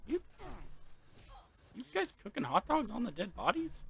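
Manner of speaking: a high, loud, disbelieving question; baffled outburst at the workers cooking on the bodies; incredulous question. Real, not acted. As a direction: ask in shock whether they're really doing it. Asks the NPCs in disbelief.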